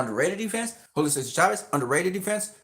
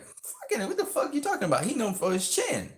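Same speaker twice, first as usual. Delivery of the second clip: much higher, softer squealed disbelief; incredulous mock rant; 7 semitones higher, 3 dB quieter than usual. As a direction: mimic yourself losing it, pitched way up. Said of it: "I was like".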